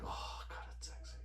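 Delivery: whispered in awe, unvoiced and hushed; awed whisper over a gun; breathy whisper. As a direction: whisper it like you're admiring something lovely. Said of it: "you're like".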